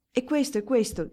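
lightly acted trembling reply. A narrator's reading. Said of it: "disse con voce tremante".

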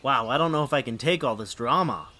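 ordinary delivery

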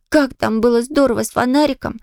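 usual speaking voice

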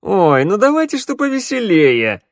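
pouting, high and loud. Acted. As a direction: whine it with a pout. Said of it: "Кобзарь надул губы".

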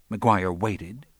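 ordinary delivery, nothing marked

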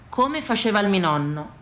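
usual speaking voice

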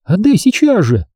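high-pitched angry demand; indignant girl's order. A narrator's reading. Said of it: "возмутилась Рен".

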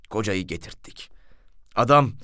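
ordinary talk, nothing marked

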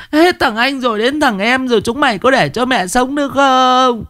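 high, lamenting mother's wail. A narrator's reading. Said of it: "Bà Liên thì khóc lóc".

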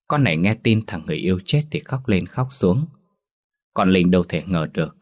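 usual speaking voice

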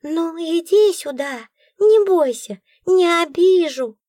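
high, slow sweet coaxing; coaxing creature. A narrator's reading. Nora coaxing the darkness out, high sweet. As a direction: lure the darkness out gently. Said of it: "ласково так приговаривать".

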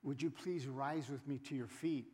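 soft, low, proper church invitation; hushed mock-formal courtesy; polite request. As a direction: imitate a decorous request to stand. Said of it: "No, you have to say".

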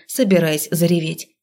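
plain reading voice

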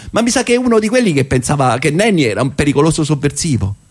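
ordinary talk, nothing marked

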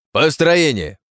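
barked command, loud and high; yelling. Acted. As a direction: shout the order to form up. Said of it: "орал Кеданур".

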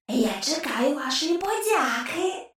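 soft, otherworldly taunting voice; a skull needling the agents, hushed and sly; ghost's whisper. A narrator's reading. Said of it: "Привид знову захихотів". (тихий потойбічний глузливий голос черепа)

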